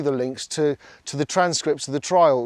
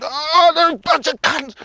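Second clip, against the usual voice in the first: hammed-up furious outburst, much higher and louder; emotional outburst; 11 semitones higher, 3 dB louder than usual. Re-enacting his reaction to the verdict news, a much higher mock-furious outburst. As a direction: let his emotions run away with him. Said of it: "I let my emotions fucking".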